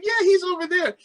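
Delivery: imitated child's voice, very high; little daughter. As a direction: answer like a small girl, high-pitched. Young daughter answering other kids.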